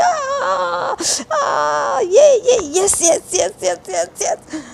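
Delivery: high joyful shrieks of yes; giddy screaming yes yes yes; ecstatic scream. Acted. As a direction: squeal like you just got proposed to. Jumping on bed, screaming with joy.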